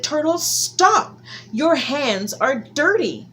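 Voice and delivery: high, loud, slow fussy scolding; Anansi scolding. Acted. Anansi stopping Turtle at the table, high slow scold. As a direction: stretch out the fake alarm.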